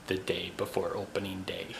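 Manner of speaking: hushed whisper. Real, not acted. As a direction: whisper it so nobody wakes. Whispering so nobody wakes up.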